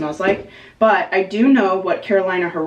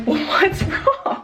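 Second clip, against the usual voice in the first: laughing question; 11 semitones higher, much slower than usual. Retold or imitated. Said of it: "And she's like".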